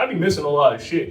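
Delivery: usual speaking voice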